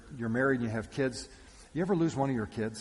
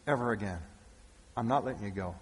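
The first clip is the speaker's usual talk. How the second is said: low, softened insistence; clinging resolve; 6 semitones lower, 6 dB quieter, faster than usual. Acted. Mary holding on to the risen Jesus, quiet resolve. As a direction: drop low and refuse to let go. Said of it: "holding on to this man going".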